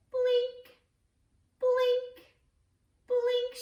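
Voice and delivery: high, bouncy blink; playful blink. Acted. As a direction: say it in a little high sing-song. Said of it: "the octopus was blinking".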